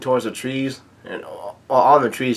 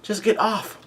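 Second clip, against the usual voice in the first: retold yell; 9 semitones higher, 4 dB quieter than usual. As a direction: imitate a yell without the volume. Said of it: "Brook yells out".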